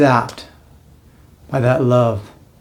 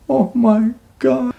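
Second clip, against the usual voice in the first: very high, slow, drawn-out wonder; acted-out awestruck exclamation; overwhelmed awe; 12 semitones higher, slower than usual. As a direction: stretch each word high, as if struck by bliss. Reenacts awe at divine touch.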